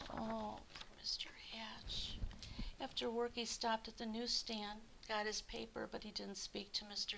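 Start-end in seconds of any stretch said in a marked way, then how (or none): 0.1-2.2 slow whispered pity; pitying sigh. A narrator's reading. Storyteller pitying lonely Mister Hatch, slow and whispered. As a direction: sigh it out with sympathy.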